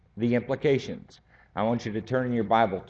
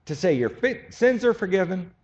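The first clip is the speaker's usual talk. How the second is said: much higher and louder, pressing urgent build; impassioned preaching; 11 semitones higher, 4 dB louder than usual. Real, not acted. Preacher stirring the congregation, high and fervent. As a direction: preach it with rising fire.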